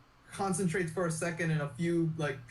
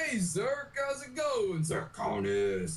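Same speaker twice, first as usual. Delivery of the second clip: higher-pitched hello, dropping on the nickname; playful fatherly greeting; 6 semitones higher, slower than usual. Acted. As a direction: greet your little son playfully, stretching his nickname. GM voices father greeting his son.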